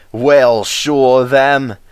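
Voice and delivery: grimly. Acted. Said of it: "said Douglas grimly".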